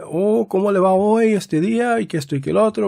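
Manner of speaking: preacher lightly playing a chatty cashier. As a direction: mimic a sweet cashier making small talk. Preacher imitates chatty friendly cashier.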